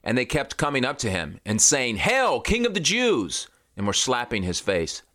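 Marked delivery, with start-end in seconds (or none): 2.0-3.5 mocking soldiers' cry, pitched high; high mocking salute; mock hail. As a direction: sneer it up high like jeering soldiers.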